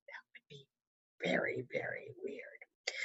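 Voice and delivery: hushed secret aside to the listener; whisper. Acted. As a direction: lean in and whisper it. Storyteller aside to the child.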